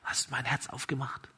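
put-on a weak boy just waking, very soft; faint whisper. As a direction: breathe the question out, very soft. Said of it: "flüstert er".

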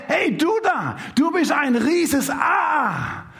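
put-on the same young man yells his taunt again, drawn-out shout; shouted insult. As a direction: call out again, jeering across the park. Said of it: "Fängt er nochmal an zu schreien".

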